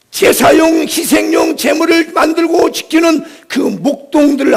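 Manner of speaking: high, driving pulpit voice; full-force preaching. Real, not acted. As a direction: preach with urgent, sustained intensity.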